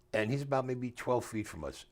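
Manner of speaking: normal talking voice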